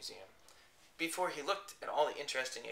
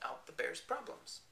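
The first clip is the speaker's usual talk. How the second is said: ordinary delivery, nothing marked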